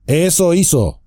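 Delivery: Strider cutting in. Acted. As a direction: snap his words back at him. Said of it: "dijo Trancos de pronto".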